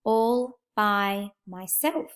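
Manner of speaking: slow whole phrase. Shown as a demonstration. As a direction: read smoothly in one slow line. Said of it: "let's start with the title of the book".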